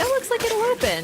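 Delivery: high quoted thought; inner voice. Retold or imitated. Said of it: "I was like".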